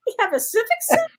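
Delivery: high, fast astonished question; amazed. Retold or imitated. Retelling shock about the town's civic center, high and amazed. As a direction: blurt the disbelief.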